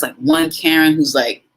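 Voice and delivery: ordinary talk, nothing marked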